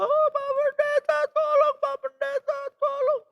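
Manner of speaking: shrieking high mock wail; imitated panicked pleading. Mother begs the pastor for help, imitated high cry. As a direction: mimic a frantic mother begging. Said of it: "mamanya bingung teriak".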